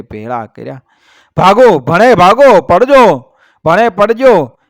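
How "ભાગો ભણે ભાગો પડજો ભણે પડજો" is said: high, loud battle cries; war shrieks. A narrator's reading. A hundred and twenty Kathis charging with war cries, loud. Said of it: "એવી કિકિયારીઓ કરતા".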